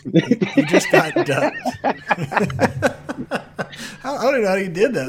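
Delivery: laughing high and delighted; giggling through the words. Real, not acted. Delighted at duck sound effect.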